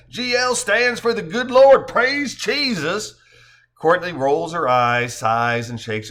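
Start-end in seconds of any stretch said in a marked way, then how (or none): 0.1-3.2 high, loud country-preacher twang. Acted. Joe explaining G L to Courtney, hammed-up country twang. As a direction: testify in a drawn-out country twang. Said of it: "Joe says with a country twang".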